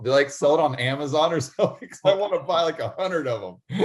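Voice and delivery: real laugh breaking into the words; eager and giggly; amused, laughing. Real, not acted. Host joking about dodging seatbelt alarm, laughing.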